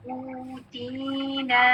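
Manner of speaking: "ऊदीना" extremely slow, every vowel held long; drawn-out example. Shown as a demonstration. Teacher repeating the example word, extremely slow. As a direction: hold each long vowel of "ऊदीना" to the end. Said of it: "इसी तरह ऊदीना".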